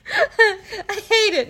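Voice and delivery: high, slow, laughing through the words. Real, not acted. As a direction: giggle it out, high and drawn. Joking about a pink item.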